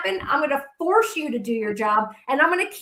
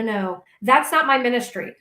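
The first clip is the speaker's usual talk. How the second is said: usual speaking voice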